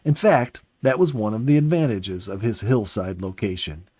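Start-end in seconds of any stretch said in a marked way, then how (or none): none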